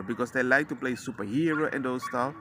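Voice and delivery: ordinary delivery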